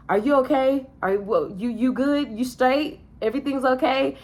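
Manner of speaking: concerned mom check-in. Retold or imitated. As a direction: gently fuss over whether she's alright.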